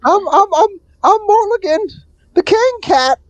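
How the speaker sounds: very high falsetto cat king; stammering high-pitched royal cat; Mulligan. Acted. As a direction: proclaim it in a squeaky cat voice. Game master voices king cat.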